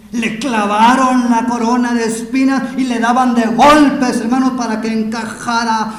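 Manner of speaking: slow, forceful phrases building to a high shout; anguished full-force sermon delivery; impassioned preaching. Real, not acted. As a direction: hammer out each cruelty at rising volume.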